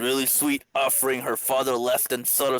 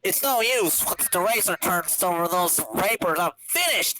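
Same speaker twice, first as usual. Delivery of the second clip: high, whining villain despair; 7 semitones higher than usual. Acted. Wheel despairs over his failing racer, high whine. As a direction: wail it like a ruined villain.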